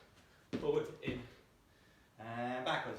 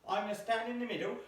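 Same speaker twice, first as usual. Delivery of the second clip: normal talking voice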